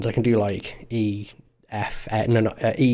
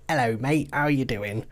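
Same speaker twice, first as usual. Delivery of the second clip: put-on cliché British accent; 3 semitones higher, 4 dB quieter than usual. Guest parodies the British guy in films, cliché British accent. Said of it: "that one British guy that's just like".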